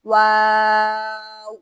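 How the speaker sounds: واو drawn out very slowly and loudly; letter waw stretched out in a slow, loud Qur'anic model; demo pronunciation. Shown as a demonstration. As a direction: hold the واو long and full, as a model for students. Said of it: "آپ note کریں گے".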